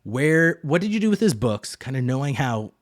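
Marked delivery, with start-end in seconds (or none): 0.0-1.8 sudden higher, louder alarmed ask; alarmed question. Retold or imitated. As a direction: blurt it out, alarmed. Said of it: "she starts questioning".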